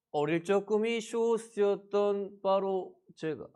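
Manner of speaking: usual speaking voice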